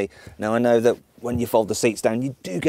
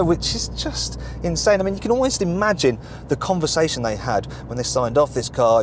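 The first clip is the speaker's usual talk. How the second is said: ordinary talk, nothing marked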